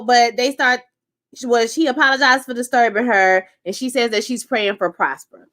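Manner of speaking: ordinary talk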